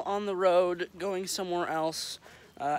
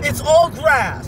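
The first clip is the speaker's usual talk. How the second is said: mock outraged shout; 7 semitones higher, 12 dB louder, much slower than usual. Mock outrage over no corn.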